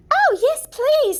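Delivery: put-on high, loud eager girlish voice; a trusting girl, delighted by the offer; innocent princess.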